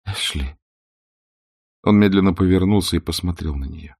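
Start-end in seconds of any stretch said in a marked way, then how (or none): 0.0-0.6 a soft, faint call of his name; quietly tremble out the name; trembling voice. A narrator's reading. Scarlett timidly calling Ashley, trembling. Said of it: "дрожащим голосом произнесла".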